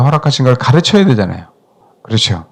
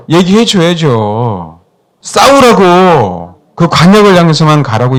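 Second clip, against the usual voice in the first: slow emphatic; 3 dB louder, slower than usual. Real, not acted.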